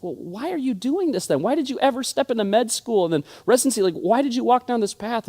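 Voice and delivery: high, baffled questioning of the friend; incredulous questions. Retold or imitated. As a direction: ask it as if you can't believe it. Speaker retells questioning his friend.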